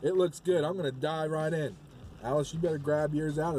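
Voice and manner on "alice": ordinary talk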